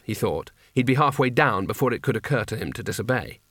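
ordinary delivery

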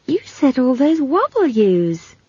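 high, slow, amazed voice; astonished girl. Acted. Esk noticing Simon's fluent W's, astonished. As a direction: point out the surprise, drawing it out.

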